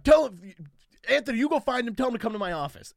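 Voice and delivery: loud, high order to the sound guy; boss barking orders. Retold or imitated.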